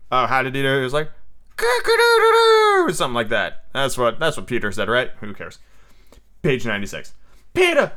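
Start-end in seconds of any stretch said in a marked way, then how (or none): 1.6-2.8 very high, loud, drawn-out crow; Peter's rooster crow. Acted. Peter signalling his return to the boys, crowing. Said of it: "They heard Peter crow". 7.5-7.9 very high, sudden cry; excited cry. A narrator's reading. Boys greeting Peter's return, crying out. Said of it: "they cried".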